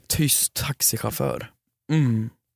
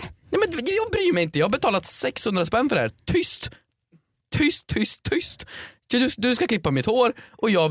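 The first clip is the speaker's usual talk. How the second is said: high, squeaky exasperated protest; rant, then fire off high-pitched hushing commands; indignant shushing; 9 semitones higher than usual. Acted. Paying customer silencing the hairdresser, indignant.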